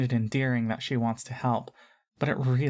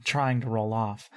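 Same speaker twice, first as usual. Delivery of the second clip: plain reading voice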